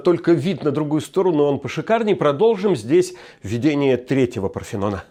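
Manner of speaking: normal talking voice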